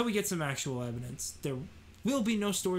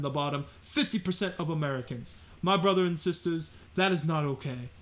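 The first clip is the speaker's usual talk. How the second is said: Bernie Sanders impression. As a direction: rail against the billionaires like an old senator at a rally. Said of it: "who always talks like this".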